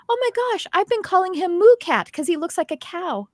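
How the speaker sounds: high-pitched retold astonishment; amazed. Young woman learning the cat's name, amazed.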